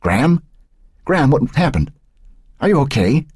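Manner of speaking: high, anxious questions; worried. A narrator's reading. Grandson arriving at the fire to his gran, worried. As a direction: check on her urgently, pitched high. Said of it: "panting and sweating from his dash".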